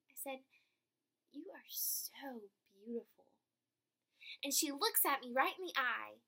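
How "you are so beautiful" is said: tender. Retold or imitated. Tells stranger softly.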